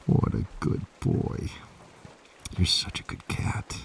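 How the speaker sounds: whispered praise for the cat; soft whisper to a good kitty. Real, not acted. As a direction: breathe the praise softly to the cat.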